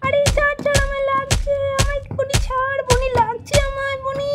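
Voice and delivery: put-on a high, loud child's cry of "let go"; hurt little boy. Brother begging his sister to let go, high and loud. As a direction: yelp like a kid whose arm is grabbed.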